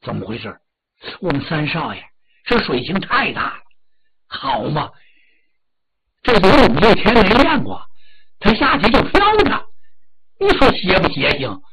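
amazed, rising to loud astonished outburst; stunned disbelief. Acted. Steward amazed at young master floating so easily, astonished. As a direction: marvel aloud, half to yourself, then burst out. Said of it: "简直把他惊呆了".

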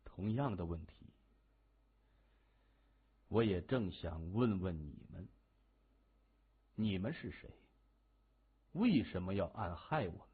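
low, quiet questioning; softly probing, in full control; cool, composed hero. A narrator's reading. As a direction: turn the question back calmly, voice dropped.

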